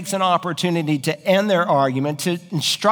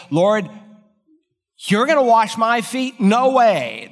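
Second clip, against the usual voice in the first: shocked Peter; 7 semitones higher, 3 dB louder than usual. Retold or imitated. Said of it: "or paraphrase".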